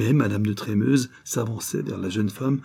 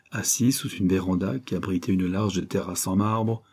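usual speaking voice

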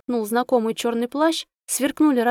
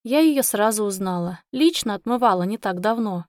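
ordinary delivery

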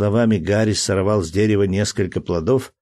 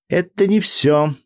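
put-on high, whiny child asking; a small boy pleading for a promise; little boy voice; 8 semitones higher than usual. A narrator's reading. Jack insisting.

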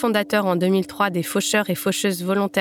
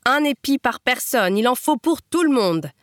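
higher-pitched proclamation; moustachioed man's ringing voice; 5 semitones higher than usual. Acted. Moustachioed man calls to the crop cutters, ringing proclamation. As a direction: call it out so it rings. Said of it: "La voix du moustachu retentit".

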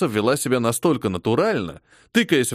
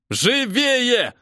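yelling; 10 semitones higher than usual. A narrator's reading. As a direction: yell to hurry them. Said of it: "орал он на новобранцев". (сержант орёт на новобранцев)

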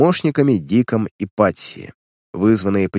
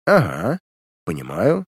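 dry. A narrator's reading. Detective noting the millionaire's motive, mildly dry. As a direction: take it in coolly, dropping on the second word. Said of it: "сухо сказал сыщик".

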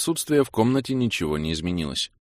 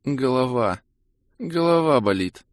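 put-on higher, pained girl's voice, eyes shut; groggy young woman; 6 semitones higher, slower than usual. A narrator's reading. Kira complains half asleep, pained higher voice. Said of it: "произнесла Кира".

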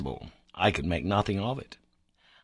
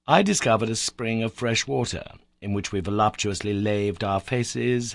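usual speaking voice

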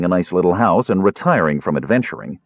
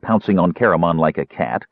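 usual speaking voice

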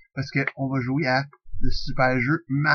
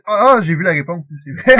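ordinary talk

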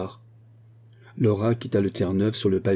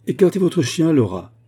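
higher and louder, angry order; 7 semitones higher, 5 dB louder than usual. A narrator's reading. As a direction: snap at her to move the dog. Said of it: "dit Dunbar avec colère". (ordre furieux, plus fort)

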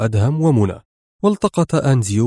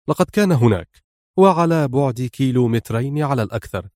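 plain reading voice

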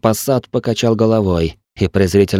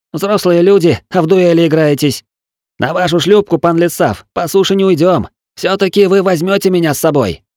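higher, lightly voiced cocky lad; cheeky young sidekick; 9 semitones higher than usual. A narrator's reading. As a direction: tease the grown men. Til's voiced lines.

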